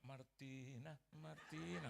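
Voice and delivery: name called out in a sing-out tone; wrong, ungentle calling. Acted. Showing how Jesus would not call her, ungentle. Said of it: "Nggak mungkin dia panggil".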